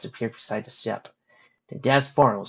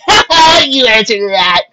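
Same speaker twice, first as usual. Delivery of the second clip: loud, high laugh into words; gleeful gotcha; 12 semitones higher, 15 dB louder, slower than usual. Acted. Rainbow catching the two ponies out, loud laughing gloat. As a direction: crow over catching them out. Rainbow crows at her friends.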